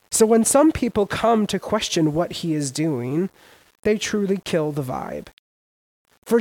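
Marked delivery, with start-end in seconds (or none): none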